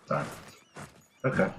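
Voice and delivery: ordinary talk, nothing marked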